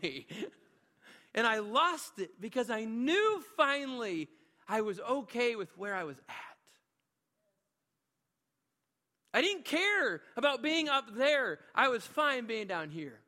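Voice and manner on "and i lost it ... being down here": preaching hard and loud, higher and higher, slowing on the key lines; preaching at full force. Acted. Preacher rousing the church about serving, at full force. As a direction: build the room up to a rousing peak.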